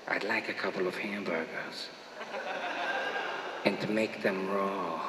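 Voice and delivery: creepy, low and very soft impression; Peter Lorre. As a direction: order food in a hushed, creepy movie-villain voice. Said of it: "he had this creepy kind of a voice".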